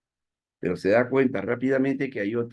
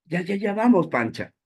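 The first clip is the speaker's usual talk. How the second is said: friend egging on; 9 semitones higher than usual. Acted. Friend voiced answering.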